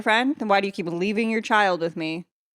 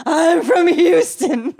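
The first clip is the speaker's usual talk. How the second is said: higher, slow, louder drawled fan voice; mock fan; 7 semitones higher, 5 dB louder, much slower than usual. Cohost imitating the fan's slow, high, loud intro. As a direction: drawl it slowly like a proud Texan. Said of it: "she's like".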